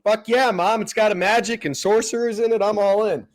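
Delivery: a hint of a thrilled child; excited kid. Kid to mom about a movie, lightly excited.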